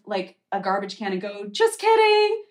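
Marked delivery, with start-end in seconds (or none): 1.5-2.4 high, loud mock game-show host; spring out yelling the prank reveal; mock candid-camera host reveal. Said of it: "waiting for somebody to jump out".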